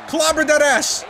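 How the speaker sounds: high, loud gloating yell; excited shout. Real, not acted. Gamer celebrating a slam on an opponent, shouting. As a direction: crow over the big hit.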